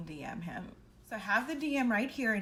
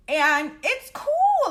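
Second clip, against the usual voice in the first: slow, high, loud gush; drawn-out thrilled; 12 semitones higher, 9 dB louder, much slower than usual. Real, not acted. Telling viewers the date went well, slow and delighted. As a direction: stretch it out, delighted.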